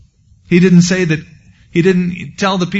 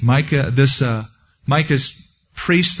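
normal talking voice

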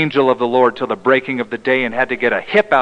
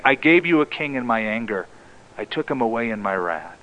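hushed repeat; 5 semitones lower, 7 dB quieter than usual. Acted. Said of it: "quoting God's verdict again, softer".

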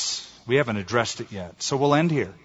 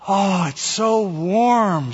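high, slow, drawn-out relief; sighing relief; 6 semitones higher, 3 dB louder, much slower than usual. A narrator's reading. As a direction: savor it with long vowels. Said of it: "sighed as the chill began to pass".

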